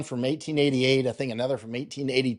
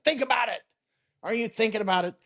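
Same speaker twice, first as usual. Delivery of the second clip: pitched way up, mock tough Marine officer; hammed-up Marine voice, much higher; Tim the Marine infantry guy; 8 semitones higher, faster than usual. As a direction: imitate a blunt infantry officer, high. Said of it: "He always talks like a Marine infantry guy".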